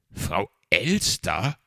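slow, loud, rising disbelief; questioning echo. A narrator's reading. Fox repeating the magpie's name, loud slow query. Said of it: "wiederholte Herr Fuchs".

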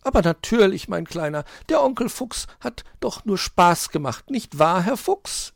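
very high, sing-song cajoling; smooth things over for the youngster; wheedling magpie. A narrator's reading. Magpie pretends fox was joking, falsely sweet.

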